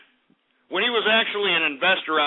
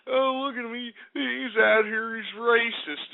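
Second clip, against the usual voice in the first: a higher, whiny mock of critics; 5 semitones higher than usual. Host mimicking online critics in a mocking whine. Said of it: "all you assholes that are out here saying".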